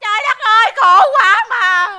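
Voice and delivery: loud wailing. Acted. Distraught woman arrives wailing at the café, noisy lament. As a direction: wail your misery for all to hear. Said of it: "mày khóc lóc um sùm".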